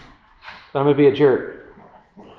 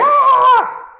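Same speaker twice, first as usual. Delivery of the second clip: high, loud mock scream of alarm; imitated scream; 5 dB louder than usual. Wife screaming at the driver pulling out, piercing scream. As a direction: mimic a startled passenger's shriek. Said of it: "Carrie screams".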